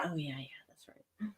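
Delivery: sheepish whisper, breathy and hushed; whispered aside. Retold or imitated. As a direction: whisper it like a guilty confession. Sheepish aside about Christy.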